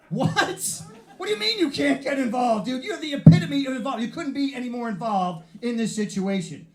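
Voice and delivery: high-pitched comic indignation; incredulous outrage. Acted. Man confronting the driver who hit him, incredulous. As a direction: protest in loud, high disbelief.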